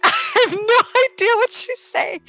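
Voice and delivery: high, strained baffled voice; baffled inner voice. Retold or imitated. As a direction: squeak it out, totally lost. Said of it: "And I'm like".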